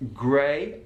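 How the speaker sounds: "grey" with a standard ay vowel; plain RP "grey". Shown as a demonstration. Teacher showing the start of the Brummie diphthong. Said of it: "that diphthong changes from".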